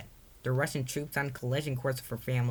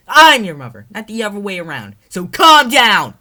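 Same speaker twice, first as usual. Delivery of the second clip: furious yell; 9 semitones higher, 21 dB louder than usual. Acted. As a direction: yell your authority at her. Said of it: "Maria yelled".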